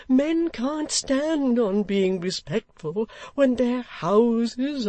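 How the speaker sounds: put-on slow, high, exasperated old man; choleric complaint. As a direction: vent his grievance, drawn out.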